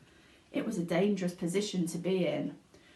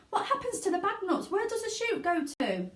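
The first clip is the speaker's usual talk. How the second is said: high, fast frantic mother; a panicked mother pleading for answers; shrieking mother; 11 semitones higher than usual. A narrator's reading. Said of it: "shrieked Missus Salt".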